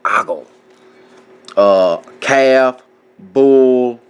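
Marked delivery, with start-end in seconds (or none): 0.0-0.5 "Ogle" said AH-gul, stress first; pronunciation demo. Shown as a demonstration. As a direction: say "Ogle" as AH-gul, falling at the end. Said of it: "I believe that's how you say it".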